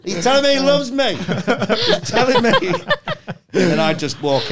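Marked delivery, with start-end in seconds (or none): 0.0-2.7 high, loud, laughing outburst; mock-emotional high wail; hammed-up joyful cry. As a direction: shout it over the noise, laughing. Acting over bear's rapping, laughing.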